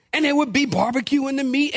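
normal talking voice

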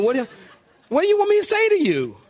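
high-pitched, helpless exasperation; exasperated. Acted. Exasperated reply to critics, voice jumps up.

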